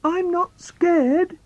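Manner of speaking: high, slow, shaky-brave reassurance; child talks himself brave in the dark; falsely brave. Acted. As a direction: insist you're not scared while clearly scared. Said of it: "So I have to say".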